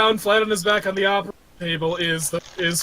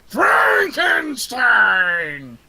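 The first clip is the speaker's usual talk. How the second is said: high, slow creature shout; lumbering Frankenstein's monster showing off; monster bellow; 5 semitones higher, much slower than usual. Acted. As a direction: roar it proudly, stretching the last word. Monster quotes sketch, roars name.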